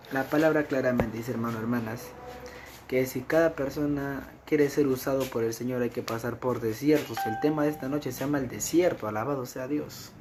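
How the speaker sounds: loud, ringing sermon voice; fervent preaching. Acted. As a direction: preach it out to the congregation. Preaching rises to full force.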